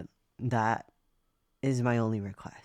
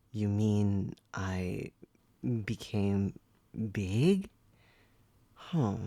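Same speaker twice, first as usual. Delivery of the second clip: normal talking voice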